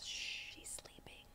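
slow, very soft whisper; barely audible, drawn-out whisper; hushed whisper. Retold or imitated. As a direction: whisper it so the child stays asleep. Retold hushing over sleeping child.

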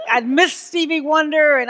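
loud, laughing aside. Real, not acted. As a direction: laugh the words out. Laughs while telling.

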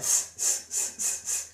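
soundless hissing laugh in short bursts; laugh to avoid. Shown as a demonstration. Coach showing how not to laugh, hissing wheeze. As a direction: laugh in voiceless puffs of air. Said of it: "essayez d'éviter de faire".

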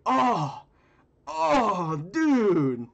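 loud, high drawn-out groaning "oh" of disgust; crowd reaction. Acted. Someone in the crowd reacting to who ripped ass, loud high outcry. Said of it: "that's like a reaction".

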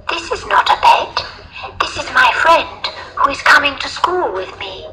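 put-on very high little girl voice. A narrator's reading. Little girl defending her friend to teacher, high child voice. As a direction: insist as a small child, pitched right up.

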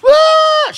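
sudden very high, loud shriek; hysterical shriek. Retold or imitated. Shocked sister-in-law losing it at the news, hysterical shriek. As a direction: burst out in a piercing imitated scream. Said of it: "She lost it".

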